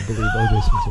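high, fast mocking quote; squeaky put-on voice with a laugh. Mock voice of James Cameron.

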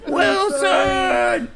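high drawn-out mock wail; Castaway cry. Quoting Castaway to a friend, high mock-anguished call. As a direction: call out for a lost friend, hammed-up.

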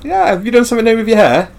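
mock small talk.

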